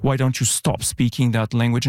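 usual speaking voice

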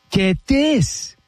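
gasp, then a high, drawn-out hook; dramatic teaser. Real, not acted. Host baiting listeners before naming the woman, dramatic teaser. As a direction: tease the big reveal with suspense. Teasing the gossip reveal.

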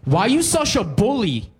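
put-on high, accusing girl's voice; angry girl. Cashier girl accusing the customer, high and indignant. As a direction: snap it at him like a cashier girl. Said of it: "looks up at me angrily and goes".

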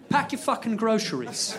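high-pitched British order; aggressive British man. Acted. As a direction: order it like a rude British bloke. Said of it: "He goes".

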